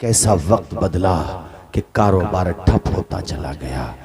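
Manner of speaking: low, soft, grave telling of a fall; hushed storytelling. A narrator's reading. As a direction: draw the crowd in with a hushed voice. Sermon story turns hushed. (धीमी, गंभीर कथा-वाचन की आवाज़)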